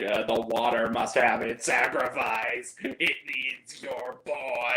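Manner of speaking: low, slow, eerie mock voice; lightly hammed-up creepy mom; ghostly mother. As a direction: intone it like a possessed mother. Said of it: "she's like".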